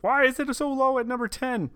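high mock outraged question; imitating Tom. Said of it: "I know, Tom, ... you say that".

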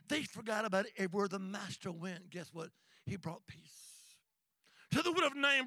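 normal talking voice

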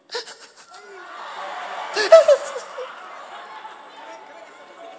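high, breathy fake sobs; hammed-up crying fit; mock sobbing. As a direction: burst into pretend sobs. Acted sobbing during the tribute.

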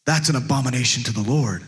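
low, grave condemnation; mock religious disapproval. Pastor mimicking religious people condemning fun, low and mock-solemn. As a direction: drop low like a stern religious scold. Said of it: "get up in arms like".

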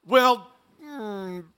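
hesitant, hedging acted reply; reluctant disciples. Disciples half agreeing to go to Samaria, reluctant. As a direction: mull it over grudgingly, word by word. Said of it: "I think the disciples went".

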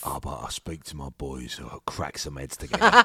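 low Cockney hard-man talk; mock gangster menace in Cockney; deep put-on Cockney; Cockney investor. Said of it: "Oh, he's Cockney".

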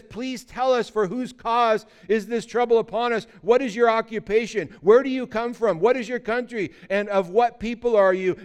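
acted sailors demanding answers; urgent interrogation. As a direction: press the stranger with high, emphatic questions.

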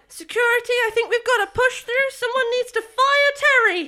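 high, urgent official voice; Tesh calling security. Acted. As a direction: call for security in a high, hurried character voice. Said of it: "reaches for her collar and is like".

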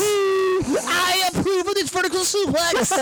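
high, nasal-free goofy nerd squeal; Conan nerd voice. Retold or imitated. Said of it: "the Conan nerd voice".

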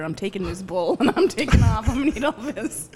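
laughing through the joke; giggling while teasing. Real, not acted. Joking about taking the bowl.

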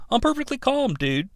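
soft, lightly voiced Big Lebowski line; John Goodman impression. A hint of a laid-back dude. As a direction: Bumblebee quoting Goodman through the radio, a mild Lebowski voice. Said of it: "quote of John Goodman".

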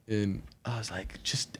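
ordinary talk

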